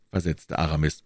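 usual speaking voice

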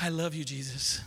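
soft, low and adoring; mock-tender disciple; lovestruck. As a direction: coo it like a devoted fan. John voiced adoring.

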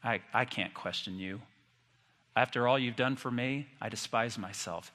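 low, hushed, halting self-reproach; humbled Job. Acted. Job admits to God he was wrong, humbled and hushed. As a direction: say it small and low, stopping between phrases. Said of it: "Job said".

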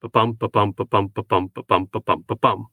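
Ba-bum seven times, stress on each bum, split three and four; iambic beat, three then four. Shown as a demonstration. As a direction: drum out da-DUM seven times. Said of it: "trimeter followed by a tetrameter".

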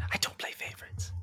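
quick, hushed aside; secretive whisper. Acted. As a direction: whisper it as a private assurance. Teacher's private aside to student.

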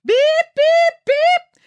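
high loud electronic beeping; alarm beeps. Acted. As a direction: mimic a monitor alarm, shrill and loud.

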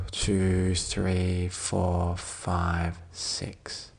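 low, softening count, drawn out; soft breath count. Real, not acted. Counting six for the listener's out-breath, soft and fading. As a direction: count down the exhale, fading to a whisper.

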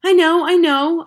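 put-on high, loud frustrated boy; loud high-pitched frustration; cried out. A narrator's reading. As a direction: burst out impatiently. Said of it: "I cried".

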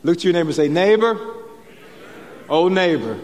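rally the room with a big shout-out. Shown as a demonstration. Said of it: "let's try this again".

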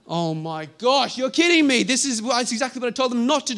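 high, loud, stunned outrage; you can't believe they did it again; exasperated disbelief. Pretend. An official hearing the preachers defied him, stunned.

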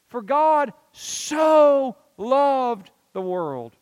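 slow right down and lean hard on "so"; stress on SO. Shown as a demonstration. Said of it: "I love to stress on that word".

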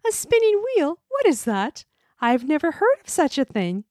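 high, loud wondering princess; cartoon princess. A narrator's reading. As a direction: ask in a bright, high innocent voice. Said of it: "said the princess".

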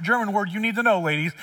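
ordinary talk

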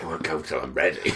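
low, soft, put-on voice; quiet mock defiance; stubborn mutter.

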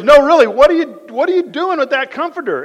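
high, loud put-on voice of his wife, scolding; mock wife voice. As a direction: squeak it out like a puzzled wife. Wife voiced in retold story.

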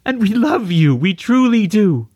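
tearful mother, very high; high-pitched and raspy. A narrator's reading. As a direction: let grief lift the voice high. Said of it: "also speaking with a high-pitched and raspy voice".

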